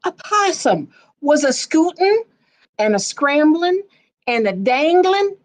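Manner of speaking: spring the words up playfully; lively narration. A narrator's reading.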